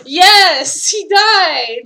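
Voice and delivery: high, loud victory cry with a gasp; cheering a won boss fight; triumphant shout. Real, not acted. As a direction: scream with relief and joy. Player winning the fight.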